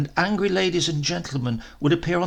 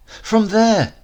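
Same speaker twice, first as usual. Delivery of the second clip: put-on higher, louder child's voice, full of importance; boy pointing eagerly; 8 semitones higher, 10 dB louder than usual. A narrator's reading. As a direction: show her where the train comes from, proudly.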